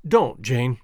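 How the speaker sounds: low-pitched, subdued plea; gently sad, voice dropped low. A narrator's reading. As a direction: say it with a touch of sorrow. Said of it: "said Clayton sadly".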